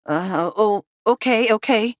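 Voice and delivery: high, panicky compliance; frightened gasp. A narrator's reading. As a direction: stumble into agreeing, scared. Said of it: "Harvey gasped".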